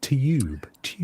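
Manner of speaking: tube said as "tyube", with a y glide; "tube" British style, tyoob; "tube" with a y before the u, tyoob; British pronunciation. Shown as a demonstration. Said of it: "we pronounce it like there's a Y after it".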